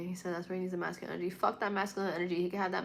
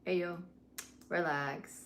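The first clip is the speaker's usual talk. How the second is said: ordinary delivery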